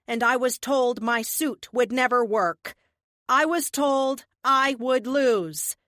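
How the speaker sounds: defiant speech. Acted. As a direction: declare it in triumph. Heroine's triumphant speech.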